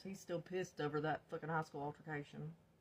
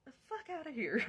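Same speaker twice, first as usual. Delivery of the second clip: disbelief; 10 semitones higher, faster than usual. Retold or imitated. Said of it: "And I'm like".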